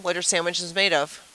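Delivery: normal talking voice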